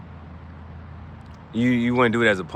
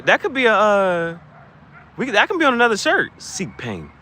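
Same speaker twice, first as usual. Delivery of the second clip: ordinary delivery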